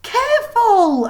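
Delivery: high, loud, drawn-out warning cry; slow cry of alarm as books fall; girl crying out careful; cried out. A narrator's reading. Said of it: "Alice cried".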